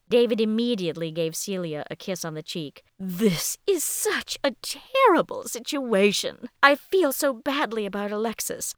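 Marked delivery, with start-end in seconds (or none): 3.0-8.7 effusive ballet master. Acted. Ballet master greeting the attacked dancer, effusively distressed. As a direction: draw out the words with grand, swooping concern.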